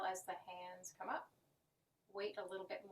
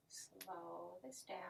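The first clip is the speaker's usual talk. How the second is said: slow this down, much softer and slower; 3 semitones lower, 10 dB quieter, slower than usual. Shown as a demonstration. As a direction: ease off, quiet and slow.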